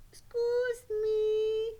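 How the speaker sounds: high, slow puppet voice; slow down and politely get attention; drawn-out high puppet request; respectful puppet Sally. Pretend. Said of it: "you need to have a strong, respectful voice".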